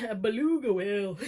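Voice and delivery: silly voice. Pretend. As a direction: say it in a random cartoonish voice. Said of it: "don't know why I said that like that".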